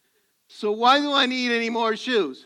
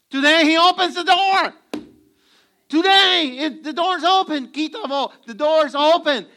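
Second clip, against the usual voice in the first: loud, high, ringing sermon climax; full-force preaching; 7 semitones higher, 7 dB louder than usual. Acted. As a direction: proclaim it to the whole congregation.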